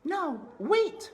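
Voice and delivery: put-on high, loud girlish plea; urgent high princess voice. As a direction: cry out to stop her. Princess stops Meg.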